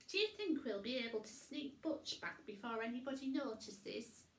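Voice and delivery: high, loud fretting witch voice; high worried witch; Winnie voice. A narrator's reading.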